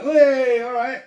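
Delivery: high bright called-out hello; cheery greeting. Retold or imitated. Recalling greeting the girls on the road, calling out cheerily. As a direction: call out a friendly hey across the road. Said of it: "And I was like".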